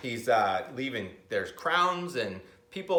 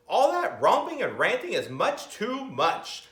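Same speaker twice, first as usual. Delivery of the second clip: stewing librarian; 7 semitones higher, 3 dB louder than usual. Acted. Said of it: "stewed the librarian".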